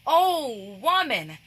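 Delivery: long, swelling O before WOMAN; slow, exclaiming O in O WOMAN; emphatic address. Shown as a demonstration. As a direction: draw out the O slowly, then land on WOMAN. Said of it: "He didn't say, "Woman".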